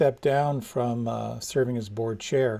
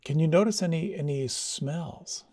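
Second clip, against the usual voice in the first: guided meditation. Acted. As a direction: ease them inward, unhurried. Leads a meditation.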